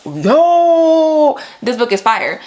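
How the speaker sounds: high, loud hyped-up burst; hyped exclamation. Pretend. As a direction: shout it like you just fell in love with the book. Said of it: "that moment where I'm just like".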